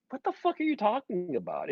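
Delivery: high-pitched incredulous question; retold exasperation. Reacting to a man lost in Holland, incredulous. Retold baffled reply to panicked plea.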